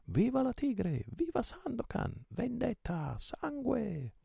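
very high, stretched-out battle cries; full-throated crowd cheer. A narrator's reading. Sailors mad with joy cheer their captain, high drawn-out yells. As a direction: cry out each slogan high and long. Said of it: "gridavano a squarciagola".

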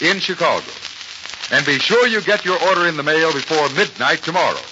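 normal talking voice

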